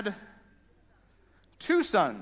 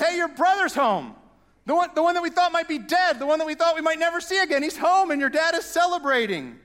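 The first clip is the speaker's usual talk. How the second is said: much higher and louder, bursting with news; excited servant; 9 semitones higher, 5 dB louder than usual. Retold or imitated. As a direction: rush the good news out breathlessly. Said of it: "he says".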